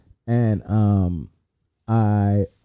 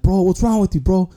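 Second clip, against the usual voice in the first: mock anger; 8 semitones higher than usual. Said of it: "I was hella mad at him".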